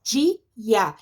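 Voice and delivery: DIA split in two, di, a; two separate beats on "Dia"; syllable by syllable. Shown as a demonstration. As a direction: say "Dia" with a gap between the vowels. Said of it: "ficaram separadas".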